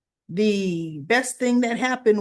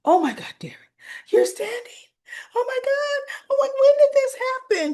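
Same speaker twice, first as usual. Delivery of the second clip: high, gushing, near-crying joy; excited squeal; 5 dB louder, much faster than usual. Retold or imitated. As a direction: squeal it out like wonderful news just landed. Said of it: "Ashley was so excited".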